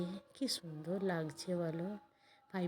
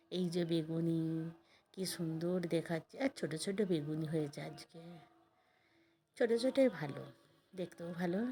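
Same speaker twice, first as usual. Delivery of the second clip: normal talking voice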